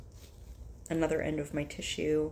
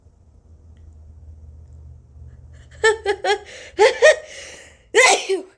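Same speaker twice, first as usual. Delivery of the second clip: loud high laugh; 14 dB louder than usual. Real, not acted. Laughs after a sneeze.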